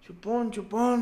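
mock yell to a teammate from the stands; retold yell. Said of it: "y me gritó".

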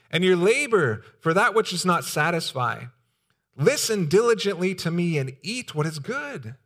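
preacher lifting the text; scripture reading. Acted. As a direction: proclaim the verse to the congregation. Reads Isaiah aloud to church.

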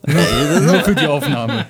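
laughing. Real, not acted. Joking about the voice.

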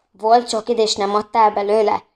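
ordinary delivery, nothing marked

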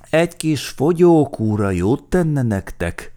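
high, loud groaning voice; groaned complaint. A narrator's reading. Carrier telling the passengers to diet, groaning. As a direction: complain under a heavy load. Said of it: "nyögte".

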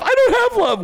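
high wailing answer; mock pitiful whine. Mocking a lonely loser's whiny reply.

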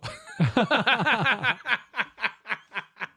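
high burst of nervous laughter. Real, not acted. Flustered after a slip on air, nervous laughing. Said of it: "que me he puesto nervioso".

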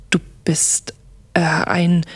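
halting, hesitant whisper; stammering. A narrator's reading. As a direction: stumble over the words in shock. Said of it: "stotterte ich". (stockend und geflüstert)

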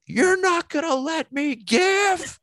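put-on very high, loud old-woman shriek; piercing high cry from the pews; old lady screaming. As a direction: shriek it like an outraged elderly churchgoer. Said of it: "she screams out".

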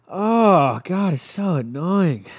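loud, high, drawn-out exasperation; exasperated groan. Real, not acted. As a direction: groan it out slowly and loudly, fed up. Exasperated at phone noise interruption.

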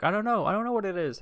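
high squeaky mock denial; mock innocence. Ryan denying the list to the teacher, squeaky mock. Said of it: "he's like".

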